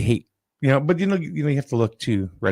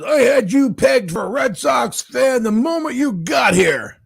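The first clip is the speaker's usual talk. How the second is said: high, loud mock drunk shout, slower; drunk angry fan; 12 semitones higher, 4 dB louder, slower than usual. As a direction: ham up the belligerent drunk yelling. Drunk fan accuses speaker.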